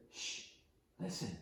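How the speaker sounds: soft hushed shush, barely voiced. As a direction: hush the kids so they can hear. Counselor hushes the campers.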